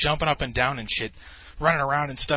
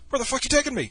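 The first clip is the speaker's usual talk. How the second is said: high-pitched comic outcry; mock alarm; 12 semitones higher, faster than usual. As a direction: blurt it like a stand-up punchline. Mock alarm, line borrowed from stand-up.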